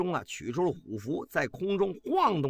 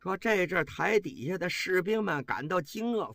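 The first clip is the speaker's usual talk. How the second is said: plain reading voice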